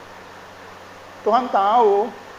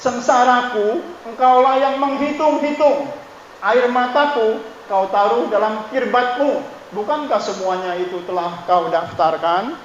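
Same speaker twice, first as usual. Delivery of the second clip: raised, louder pleading voice from the pulpit; fervent scripture recitation; 4 semitones higher, 3 dB louder than usual. Acted. Pastor to congregation, reciting the psalm in a raised fervent voice. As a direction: cry the psalm out to God, higher and louder.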